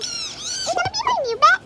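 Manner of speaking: very high, loud, delighted squeal; thrilled about a new bed; high happy shriek; excited squeal. Real, not acted. Squealing over new find.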